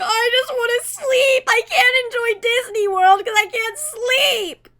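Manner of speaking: high, loud, drawn-out whine; slow, loud tantrum over lost sleep; sleepless whining shout. Acted. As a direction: wail it in mock exasperation.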